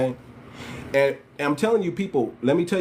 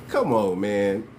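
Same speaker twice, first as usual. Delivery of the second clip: ordinary delivery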